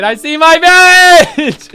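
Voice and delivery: high, loud, drawn-out acted joy; overjoyed shout. Character seeing their village through the portal, thrilled shout. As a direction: cry out at the sight of home.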